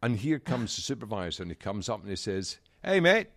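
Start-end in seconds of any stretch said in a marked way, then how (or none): 2.8-3.3 loud, high Aussie hail; mock Australian shout; Australian accent. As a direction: call out like an Australian supervisor. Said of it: "Is that your Australian accent?".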